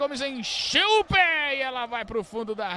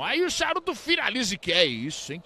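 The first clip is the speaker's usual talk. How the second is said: ordinary delivery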